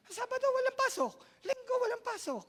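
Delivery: very high, loud gleeful quote; delighted high mock voice; high excited falsetto. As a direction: sing out the good news in falsetto. Voicing happy worker.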